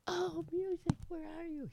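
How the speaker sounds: sing-song falsetto calling out for the music; mock falsetto call. Host calling for music cue.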